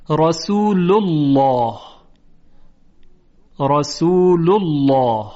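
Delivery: رسول الله slow, "rasulullaw" with a dark thick l; heavy lam. Shown as a demonstration. Teacher giving examples, slow heavy رسول الله. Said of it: "لام الله تفخیم بشه".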